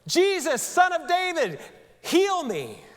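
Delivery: high, loud cry to Jesus; yelling for help. Acted. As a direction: shout across the crowd for healing. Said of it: "he starts yelling".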